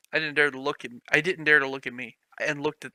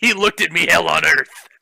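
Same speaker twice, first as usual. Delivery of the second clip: much louder, hammed-up dramatic line; mock dramatic; 3 semitones higher, 11 dB louder than usual. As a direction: belt it like a cheesy horror trailer. Joke riffing on fic line.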